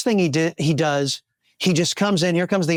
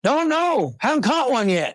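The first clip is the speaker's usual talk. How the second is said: country farmer; 7 semitones higher than usual. Acted. Farmer's punchline in joke.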